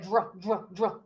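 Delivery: short, soft, repeated "dr" blend; quiet, separate bursts of "dr"; sounding out. Shown as a demonstration. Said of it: "that dr sound".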